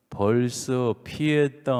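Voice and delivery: slow, drawn-out father's reply; unhurried mock Chungcheong deadpan; slow Chungcheong drawl. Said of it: "이렇게 말했대요". (느긋하게 늘여 빼는 충청도 아버지 말투로)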